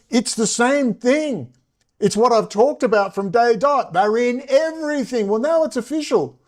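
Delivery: high-pitched, worked-up exasperation; exasperated rant. Real, not acted. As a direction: plead it at a rising pitch, incredulous.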